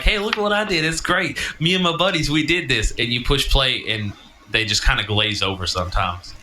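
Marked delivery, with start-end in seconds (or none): 0.0-2.9 high, eager bragging to friends; proud show-off. Retold or imitated. Friend bragging to buddies about podcast, excited. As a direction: show off your work like a kid. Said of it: "I'm like".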